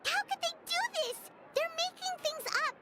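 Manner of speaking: outraged disbelief at the tabloid lies; indignant outburst. Acted. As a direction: protest the unfair lies. Paimon upset over tabloid lies.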